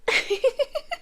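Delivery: a quick breathy giggle; soft laugh. Acted. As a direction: laugh at your own silliness. Wry laugh at herself.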